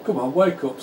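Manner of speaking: ordinary talk, nothing marked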